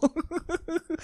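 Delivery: high, quiet wheezy giggle; real giggle. Real, not acted. Comic cracking up at his own cow joke, high giggle.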